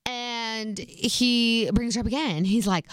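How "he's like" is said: ordinary talk, nothing marked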